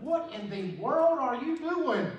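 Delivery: high, loud, slow startled cry; startled question. Retold or imitated. Retelling the night scare in Dallas, startled and loud. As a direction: throw it out like you just got scared.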